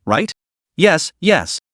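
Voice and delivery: plain reading voice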